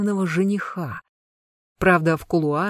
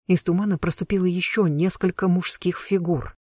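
usual speaking voice

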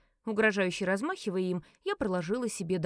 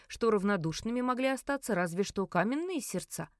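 ordinary delivery

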